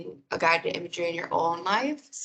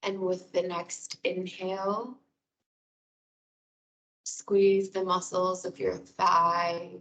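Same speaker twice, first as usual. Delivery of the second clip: guided meditation. Real, not acted. Wellness coordinator guiding listeners through breathing and muscle release, guided meditation.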